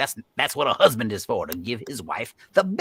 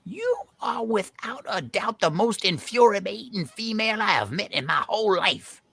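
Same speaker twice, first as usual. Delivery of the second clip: exasperated anger; 8 semitones higher than usual. Acted. Quarrel, insulting the meddler.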